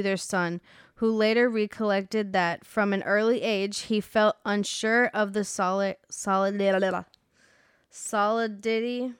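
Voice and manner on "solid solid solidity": stumbling with a numb mouth; words thick from a numbed lip. Real, not acted. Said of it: "my mouth is numb right now".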